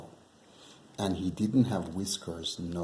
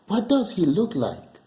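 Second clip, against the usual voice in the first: put-on little child voice; 10 semitones higher, 6 dB louder than usual. Child asks about the mouse.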